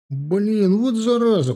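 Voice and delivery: high-pitched whining curse; vexed young soldier. A narrator's reading. Soldier discovering dirt on his boot, vexed and high.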